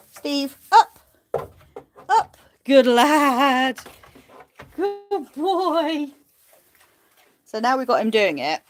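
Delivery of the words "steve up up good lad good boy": high, louder command then praise. Real, not acted. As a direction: train him. Training command.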